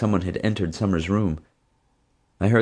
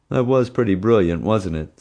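teasing; 3 semitones higher, 3 dB louder than usual. Acted. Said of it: "Summer teased".

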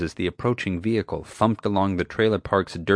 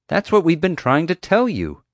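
high-pitched, frustrated insistence; exasperated; 10 semitones higher, 4 dB louder than usual. A narrator's reading. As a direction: hammer it home, fed up.

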